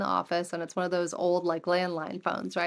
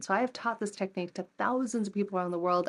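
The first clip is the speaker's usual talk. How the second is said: normal talking voice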